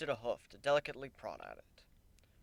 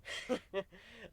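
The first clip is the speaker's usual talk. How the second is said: sly laugh; 8 semitones higher, 6 dB quieter than usual. A narrator's reading. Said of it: "Warren said with a laugh".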